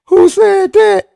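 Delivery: mock the friend playing innocent; mocking squeak. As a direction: tease him with a high mock voice.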